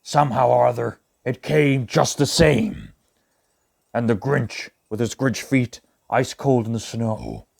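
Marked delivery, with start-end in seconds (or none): none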